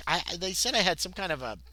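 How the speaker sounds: ordinary talk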